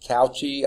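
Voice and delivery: Cauchy said as COW-chee; Cauchy misread by spelling, as COW-chee; alternative pronunciation. Shown as a demonstration. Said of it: "I don't know how you pronounce it".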